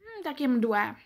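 speaking between bites; talking while chewing. Real, not acted. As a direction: mouth partly full of food. Seen 0: Pasta eaten just before; jaw and lips still chewing while speaking.

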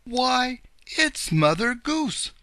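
high, loud cry of recognition; excited cry. A narrator's reading. Bunny uncle spotting Mother Goose, excited cry. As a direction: call it out high and loud, drawing out the name. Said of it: "cried Uncle Wiggily Longears".

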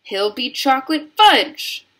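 high, loud outcry; loud, frantic mother's cry; shrieked protest. A narrator's reading. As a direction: shriek in horror for your son. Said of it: "shrieked Missus Gloop".

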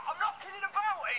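loud, high, heated retort; angry insistence. Acted. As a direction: raise your voice and insist. Heated quarrel.

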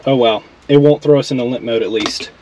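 ordinary talk, nothing marked

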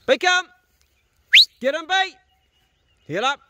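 high, loud call across the field; calling out to the dog. Real, not acted. Farmer sending his dog after stock, high loud call. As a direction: shout the dog's name to send him on.

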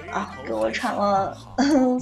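ordinary talk, nothing marked

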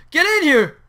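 high, loud call; sudden urgent holler; shouted order. Real, not acted. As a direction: yell a command. Calls into the village house.

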